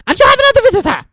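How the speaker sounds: bustling announcement; matron's voice. Acted. Madame Pomfrey voiced.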